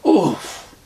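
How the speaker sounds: knocked breathless on the back; winded. A narrator's reading. Dad landing flat after the fall, winded. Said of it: "I said, winded".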